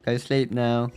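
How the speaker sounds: usual speaking voice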